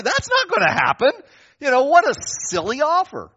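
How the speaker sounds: high, loud disbelieving cry; incredulous exclamation. Acted. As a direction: squeal that's not gonna happen in mock outrage. Mocking the silly offer.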